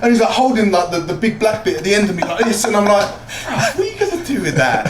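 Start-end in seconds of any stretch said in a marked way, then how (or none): 3.5-4.9 high-pitched comic disbelief; high, mocking incredulous question; retold incredulous challenge. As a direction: scoff at a useless threat, acting it out. Acted scoffing at cone as weapon.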